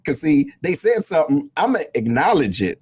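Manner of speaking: usual speaking voice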